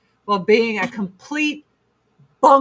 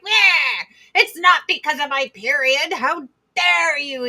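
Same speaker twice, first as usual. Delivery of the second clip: mock defensive denial; 4 semitones higher, 4 dB louder than usual. Her defensive denial voiced.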